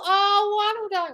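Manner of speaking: high, cooing congratulation; "oh, wonderful" sung out high; gushing delight. Real, not acted. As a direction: gush with high-pitched delight. Gushing at viewer's good news.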